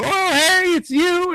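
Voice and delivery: high, loud goofy mock voice; mock cheerful greeting. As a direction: parody the characters' jokey relief. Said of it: "they go".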